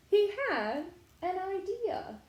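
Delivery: ordinary delivery, nothing marked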